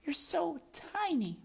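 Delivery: the princess cooing to Despero, high and sweet. Acted. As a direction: coo it like a doting princess. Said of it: "said the princess".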